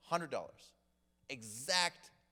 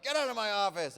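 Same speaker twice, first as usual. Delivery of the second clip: loud, high mock yell; hammed-up shouted dismissal; mock angry boss; 7 semitones higher, 10 dB louder than usual. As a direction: bellow it as an outraged boss. Boss throwing employee out, acted.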